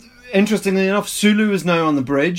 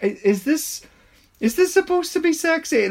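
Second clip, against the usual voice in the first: high, baffled question; incredulous; 13 semitones higher than usual. Retold or imitated. Reacting to awkward smiling women, incredulous. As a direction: rise sharply in disbelief. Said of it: "it's just like".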